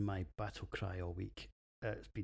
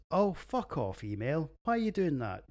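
higher, louder exasperated swearing; annoyed outburst; 6 semitones higher, 5 dB louder than usual. Real, not acted. His email pings mid recording, annoyed outburst. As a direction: snap at the interruption.

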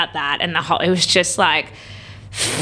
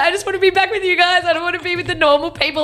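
much higher, laughing mock plea; high laughing imitation of herself; 10 semitones higher, faster than usual. Her high self pleading to her Truman group, laughing. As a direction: whine it high through laughter. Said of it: "And I was like".

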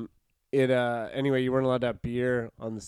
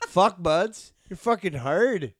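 louder hammed-up Canadian accent, higher; mock Canadian; 5 semitones higher, 4 dB louder than usual. Mocking Canadian bedroom talk, loud Canadian accent. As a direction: ham up the Canadian lover. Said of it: "Canadian sex talk is incredible".

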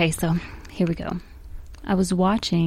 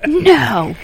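mock loud no; 5 semitones higher, 8 dB louder than usual. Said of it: "I'm ready to be like".

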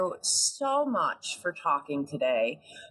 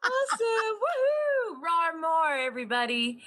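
excited cheering; 12 semitones higher than usual. Real, not acted. Cheering after the roar.

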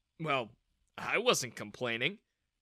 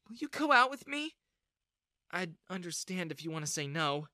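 shy confession; 7 semitones higher than usual. A narrator's reading. Bella shyly confesses her feelings.

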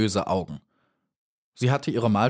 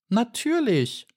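much higher, slow matter-of-fact; dragonfly, much higher; offhand dragonfly; slower than usual. A narrator's reading. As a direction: say it as obvious. Dragonfly answers.

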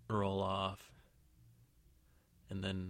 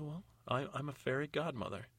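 higher-pitched, hesitant character voice; flustered fairy godmother; 6 semitones higher than usual. Acted. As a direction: answer defensively in a higher voice. Fairy godmother answers interrogation.

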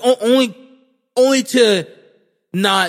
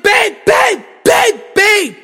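high, loud repeated calls; urgent shouting; 13 semitones higher, 6 dB louder, slower than usual. Retold or imitated. Boyfriend interrupting his panicking fiancée, high urgent shouts. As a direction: shout over someone's panic to get through. Cutting through girlfriend's panic.

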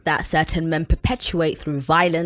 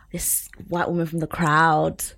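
whisper; 3 semitones lower, 3 dB quieter than usual. Real, not acted. Gossipy aside about audience member.

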